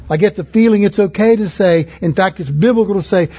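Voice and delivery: ordinary talk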